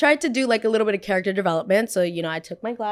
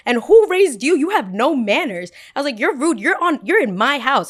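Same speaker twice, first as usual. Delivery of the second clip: outraged scold; 6 semitones higher, 6 dB louder than usual. Retold or imitated. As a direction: scold him like a mother. Scolding rude boy.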